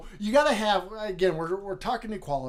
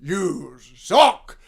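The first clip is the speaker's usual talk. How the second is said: loud, grunted Frankenstein verdict; hammed-up monster judge, blasting each word; mean zombie judge; 10 dB louder than usual. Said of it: "the mean judge".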